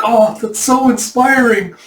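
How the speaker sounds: hammed-up awestruck praise; mock gushing. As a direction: gush slowly like an inspired soldier. Soldiers inspired by their lord.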